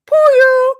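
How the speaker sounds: cute squeak. Acted. Creature squeak.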